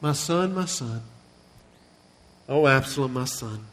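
soft, fading grieving cry; a father mourning his dead son, fading to a hush; weeping lament. Retold or imitated. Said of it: "he just cries".

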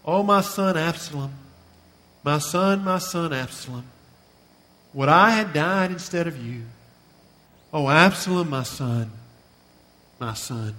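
soft, fading grieving cry; a father mourning his dead son, fading to a hush; weeping lament. Retold or imitated. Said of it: "he wept out".

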